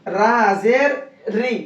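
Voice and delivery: slow spelling to a light "री"; thin Ra. Shown as a demonstration. Teacher answering how to read it, thin Ra. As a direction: draw out "रा जेर री" with a thin final R. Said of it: "बारीक".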